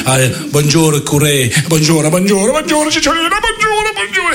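"bonjour" climbing to a squeak; flustered priest. Acted. Priest greets Cicciolina, getting squeakier with each bonjour.

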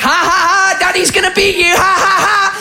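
high, taunting laugh-talk; gloating mock laughter. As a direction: taunt a child while laughing through it. Gloating father racing past crying daughter.

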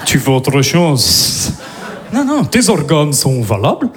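low, very slow mock Russian thug; very low slow mock Russian menace; menacing Russian voice. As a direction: threaten in the deep Russian voice. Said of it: "tu changes ta voix".

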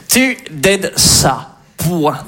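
slow, drawn-out classroom dictation; mock-solemn schoolteacher, stretching the syllables; teacher giving dictation. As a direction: read it out slowly for pupils to write down. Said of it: "vous imaginez les dictées".

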